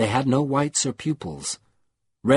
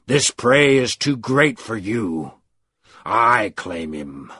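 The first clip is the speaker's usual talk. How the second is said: commanding dark voice; 4 dB louder, slower than usual. A narrator's reading. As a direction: speak slowly like a voice from the abyss. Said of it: "it commanded".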